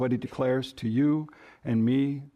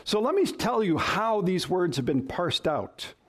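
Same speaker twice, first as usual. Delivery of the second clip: ordinary talk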